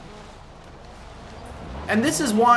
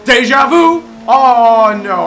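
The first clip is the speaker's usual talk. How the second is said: loud, high shout of glee; belting it out in delight; excited yell; 5 semitones higher, 12 dB louder, slower than usual. Real, not acted. Yells the song title.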